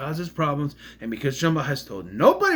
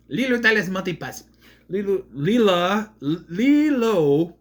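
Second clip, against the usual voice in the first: Fifth Element quote; 3 semitones higher, 4 dB louder than usual. Pretend. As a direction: stretch the name like the Leeloo line. Riffing on a film quote.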